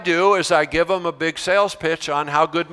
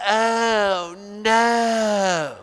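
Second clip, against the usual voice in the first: higher, wailing drawn-out groan; mock despairing groan; 5 semitones higher than usual. Teacher imitating students groaning at an announcement, mock wail. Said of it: "They go".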